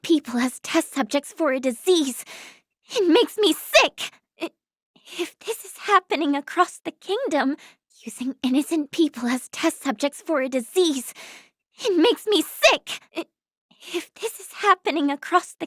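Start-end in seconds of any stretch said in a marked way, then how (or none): 2.9-4.1 disgusted. Acted. Outrage at experiments on innocents. 11.8-13.0 disgusted. Acted. Outrage at experiments on innocents.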